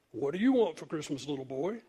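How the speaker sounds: a hint of department-store Santa, softening toward the end; lightly voiced Santa. Santa to little Ralphie on his lap, lightly voiced. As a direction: lightly play Santa questioning a child. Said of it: "set him on Santa Claus's lap".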